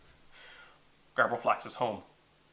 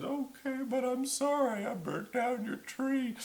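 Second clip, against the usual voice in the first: soft, high creature voice; meek, quiet and high-pitched; sheepish dragon apology; 10 semitones higher, 8 dB quieter than usual. Acted.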